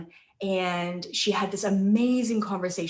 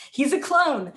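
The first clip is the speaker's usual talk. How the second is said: laughing through a joke, higher and louder; 6 semitones higher, 3 dB louder than usual. Real, not acted. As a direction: burst out laughing on the punchline. Joking punchline about the husband.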